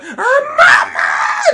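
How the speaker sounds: very high, loud cry for mother; wailing little sister. Retold or imitated. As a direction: shriek for mama like a child in a fight. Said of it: "the sister".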